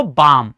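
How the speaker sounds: बाम with silent l; long aa straight into m in बाम; right pronunciation. Shown as a demonstration. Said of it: "क्या बोलते हैं इसको". (बाम, 'ल' चुप रखकर)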